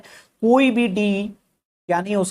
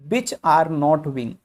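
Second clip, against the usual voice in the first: slow dictation; much slower than usual. Shown as a demonstration. Teacher reading the statement slowly.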